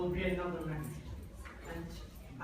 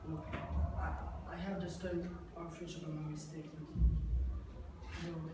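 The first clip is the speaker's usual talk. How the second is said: remorseful; 3 semitones lower, 7 dB quieter than usual. Acted. Father apologises after the fight.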